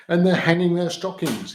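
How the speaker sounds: loud, high sneering snarl; mild hammed-up Grinch snarl. A narrator's reading. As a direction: sneer it out like a grumpy villain. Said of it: "he snarled with a sneer".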